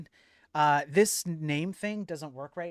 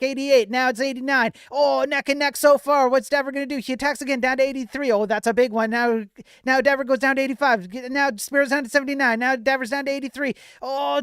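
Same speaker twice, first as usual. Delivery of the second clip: excited play-by-play, building up louder; sports commentator; 5 semitones higher, 4 dB louder than usual. Acted. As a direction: call the fight like a ringside announcer. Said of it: "get my commentator voice on".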